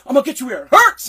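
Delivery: loud, quick, fluent mock threat; imitated threat without stutter. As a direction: run it out without a stumble, loud, imitating an angry boss. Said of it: "he didn't stutter that part".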